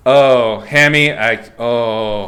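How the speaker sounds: normal talking voice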